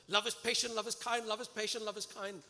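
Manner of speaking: loud, high, tense chanting through the anger; gripping the steering wheel, chanting himself calm, loud; forced loud mantra. Retold or imitated. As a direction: repeat it hard like a mantra while gripping the wheel. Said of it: "and I used to go".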